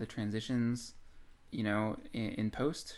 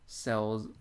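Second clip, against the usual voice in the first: ordinary delivery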